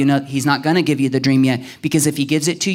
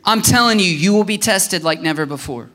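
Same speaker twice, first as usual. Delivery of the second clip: normal talking voice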